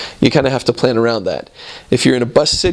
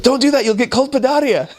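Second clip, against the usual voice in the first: much higher, urgent mock warning; imitating alarmed Italian passenger; 12 semitones higher than usual. Italian on the bus warning a missionary off the fan, high mock alarm. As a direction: play a worked-up stranger, pitched way up. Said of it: "he starts speaking to me really fast".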